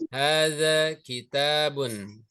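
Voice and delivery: Hadza baitun drawn out slowly, syllable by syllable; a teacher modelling Arabic for students to repeat; slow model pronunciation. Shown as a demonstration. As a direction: say Hadza baitun slowly so the class can copy. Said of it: "Baik, sama-sama".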